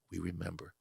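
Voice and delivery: almost breathe it, moved; a moved mother answering quietly; mom's hushed reply. Retold or imitated. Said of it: "She goes".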